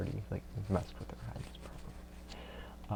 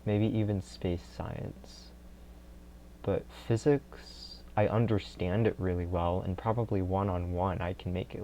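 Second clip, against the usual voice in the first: normal talking voice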